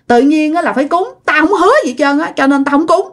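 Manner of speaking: acted stubborn protest; stubborn defiance. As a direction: push back as a pig-headed man. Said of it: "ông Tám Sản ổng rất là ngang".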